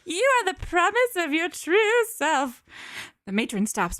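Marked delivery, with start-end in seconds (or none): 0.0-2.6 character voice. Acted. Reading a character's line.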